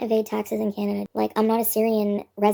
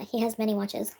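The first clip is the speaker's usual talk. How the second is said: usual speaking voice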